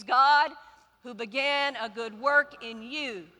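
loud start, calling the words out; call-and-response verse; congregational recitation. Acted. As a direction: lead the crowd in saying it. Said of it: "say it with me".

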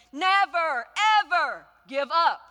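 full-throated Churchill bellow; big Churchill voice. A narrator's reading. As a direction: roar it out like Churchill at the podium. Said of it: "in a big, big Churchill voice".

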